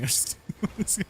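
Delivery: breathy laugh. Real, not acted. Laughs after joke.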